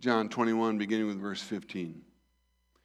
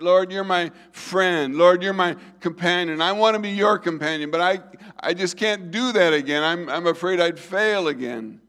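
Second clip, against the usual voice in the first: anguished plea; 6 semitones higher, 7 dB louder than usual. Acted. Peter voiced pleading fear of failure.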